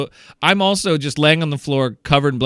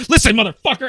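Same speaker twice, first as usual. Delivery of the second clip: higher, louder mock challenge; hammed-up furious brother calling him out; angry threat; 10 semitones higher, 5 dB louder, faster than usual. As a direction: square up to him, voice raised. Brother threatens, voiced in story.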